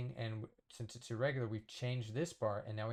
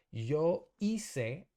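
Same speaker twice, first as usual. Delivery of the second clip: slow model reading; 4 semitones higher, 7 dB louder than usual. Shown as a demonstration. Teacher models a slow example.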